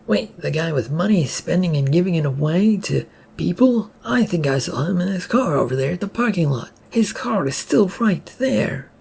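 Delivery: a bystander pointing out the car, a mild shout. Acted. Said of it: "another person from the crowd shouted out".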